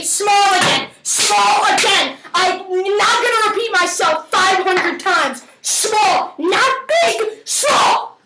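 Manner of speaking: high, emphatic scolding; mock exasperation. Cook scolding viewers about chopping size, mock exasperation. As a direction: punch each instruction as if fed up repeating it. Fed up repeating the instruction.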